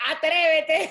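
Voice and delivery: loud dare through a laugh; laughing mock threat. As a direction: threaten him, then laugh. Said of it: "en verdad es y que".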